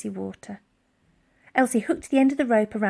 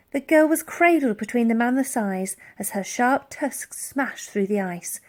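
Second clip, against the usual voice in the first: plain reading voice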